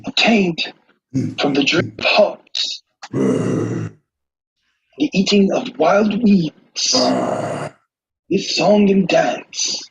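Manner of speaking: high, measured wildlife narrator; mock nature documentary narration. Friend narrates the red peacock in his natural habitat, high documentary voice. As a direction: narrate with grand, lingering reverence.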